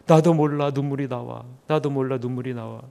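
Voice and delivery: imitating a soldier choked up in worship; softly retold weeping friend; quiet teary murmur. Soldier answers while crying.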